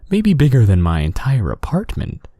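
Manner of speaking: usual speaking voice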